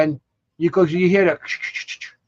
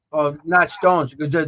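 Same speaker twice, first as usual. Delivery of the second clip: ordinary delivery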